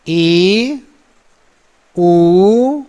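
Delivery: ई and ऊ drawn out, two beats each; long vowels. Shown as a demonstration. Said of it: "ह्रस्व का दो गुना".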